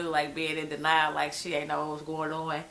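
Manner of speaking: ordinary talk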